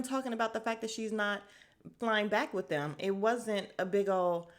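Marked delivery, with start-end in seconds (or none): none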